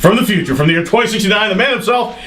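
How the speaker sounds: big wrestling entrance announcement; ring announcer intro. Acted.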